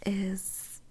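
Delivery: normal talking voice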